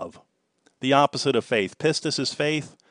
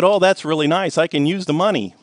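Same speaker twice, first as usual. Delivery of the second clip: hammed-up excitement, a bit louder; teasing the scammer with fake eagerness; mock eagerness; 6 semitones higher, 5 dB louder than usual. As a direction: play along like a thrilled winner. Playing along eagerly.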